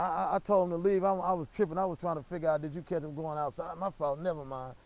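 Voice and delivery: usual speaking voice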